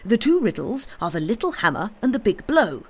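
high, insistent reasoning; carried away by a glimpse of the truth; feverish excitement. A narrator's reading. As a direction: rattle out the theory eagerly. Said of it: "he cried feverishly".

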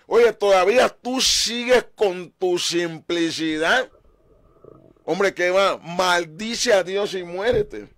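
wife's mocking taunt. Job's wife taunting him to curse God, loud and scornful. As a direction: sneer it like a scolding wife. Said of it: "y le diga".

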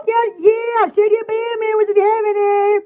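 usual speaking voice

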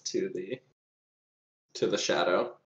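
ordinary delivery

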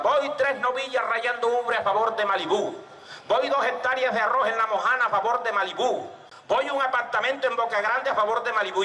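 high, sing-out wagers; bettors calling bets. Acted. Bettors backing Malibú before the fight, high calling. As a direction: call each bet to the crowd.

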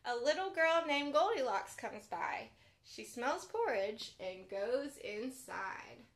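gentle bedtime storybook read; reading to children. A narrator's reading. Children's librarian reading a pop-up book aloud, gently.

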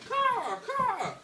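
high cawing crow call. A narrator's reading. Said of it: "He flew into the forest crying".

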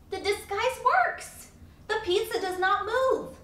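high, loud, gleeful cry of success; the owl shrieks with delight as the plan works; triumphant squeal. Acted. Owl delighted its disguise fooled the pizza, triumphant squeal.

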